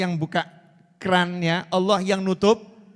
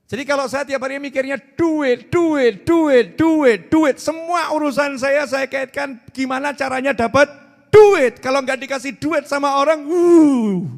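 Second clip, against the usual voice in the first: higher, louder, hammering every duit; mock greed; 7 semitones higher, 7 dB louder than usual. Preacher mocks his own greed to the crowd, hammed-up obsessive ranting. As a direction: rant like a man who only thinks of money. Mocking money-obsessed man.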